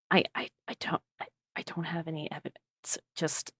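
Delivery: very soft, stammering near-whisper; hesitant admission. Acted. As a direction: admit it haltingly under your breath. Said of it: "she'll just say".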